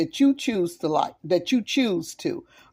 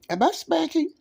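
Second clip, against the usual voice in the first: laughing; 9 semitones higher than usual. Real, not acted. Asks pleased question, laughs.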